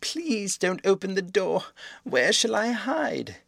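slow, high desperate plea; terrified pleading. A narrator's reading. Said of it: "he screamed".

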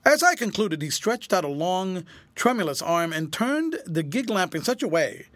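ordinary delivery

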